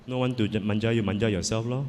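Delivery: normal talking voice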